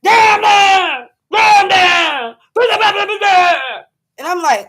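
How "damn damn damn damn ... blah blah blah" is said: loud, higher mock screaming gibberish; imitated yelling. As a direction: holler like the screaming neighbours. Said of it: "they be yelling and screaming".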